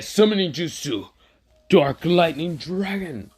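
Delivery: jutsu call. Acted. Hero calls his summon.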